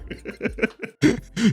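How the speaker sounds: high, soft chuckle; laugh. Real, not acted. Host amused by his own remark, chuckling. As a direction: chuckle at your own joke.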